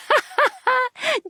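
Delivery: high, giggly laugh at own joke; light, teasing laughter; joking laugh. Acted.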